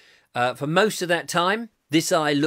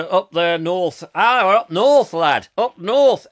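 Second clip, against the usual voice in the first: louder, slower, higher hammed-up northern English accent; mock northern accent; 4 semitones higher, 9 dB louder than usual. Host mocking Robert's speech, hammed-up northern English accent. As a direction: ham up a broad northern English accent for a laugh.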